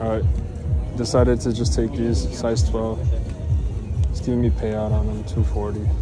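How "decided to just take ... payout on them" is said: ordinary talk, nothing marked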